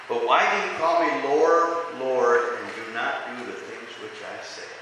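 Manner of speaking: slow read with the repeated "Lord" stressed; emphasis on Lord. Shown as a demonstration. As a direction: lean on "Lord, Lord" as a rebuke. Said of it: "he uses the word Lord twice. That's for emphasis".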